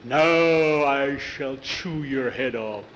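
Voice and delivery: put-on a kid faking a scary monster. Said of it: "Jackie changed his voice".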